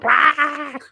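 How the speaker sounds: sudden high yelp of overwhelm; a loud high startled wah. Retold or imitated. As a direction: blurt it out high and loud like being hit by the city. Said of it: "it's like".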